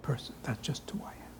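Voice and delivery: mock meek person fading to a whisper; hammed-up sugary whisper of a pushover; meek, sweet persona. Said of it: "Or people say".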